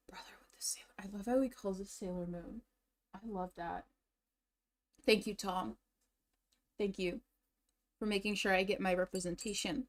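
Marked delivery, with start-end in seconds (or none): 0.1-3.8 soft unvoiced whisper. Real, not acted. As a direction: whisper it as an aside. Aside while clip plays.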